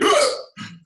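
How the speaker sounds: sharp high hiccup; a hiccup catches him mid-sentence. Acted. Hiccups interrupting his talk.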